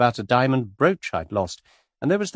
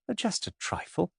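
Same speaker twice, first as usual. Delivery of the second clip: softer, higher sheepish reply; apologetic; 7 semitones higher, 8 dB quieter than usual. A narrator's reading. Virginia to George, softly apologetic. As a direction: play it down sheepishly. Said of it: "said Virginia apologetically".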